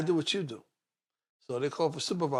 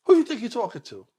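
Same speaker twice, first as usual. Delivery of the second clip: rising indignation; 11 semitones higher, 6 dB louder than usual. Retold or imitated. Confronting the cop, rising shock.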